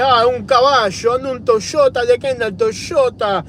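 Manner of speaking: high, sing-song Argentinian Spanish with "sho" sounds; Argentinian Spanish accent. Acted. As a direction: play a fancy Argentinian guy, accent laid on thick. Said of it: "the Argentine guy's like".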